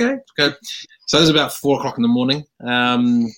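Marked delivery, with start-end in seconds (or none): 0.0-0.5 high, resigned okay. Retold or imitated. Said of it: "So I was like".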